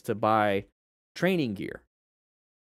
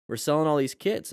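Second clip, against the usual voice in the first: normal talking voice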